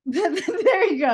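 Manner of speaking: said through a high, slow laugh. Real, not acted. Laughing over a shared joke.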